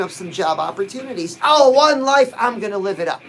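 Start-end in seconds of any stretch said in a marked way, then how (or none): 1.4-3.2 wholehearted affirmation. Shown as a demonstration. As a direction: belt it out with conviction. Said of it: "But we can say it anyways".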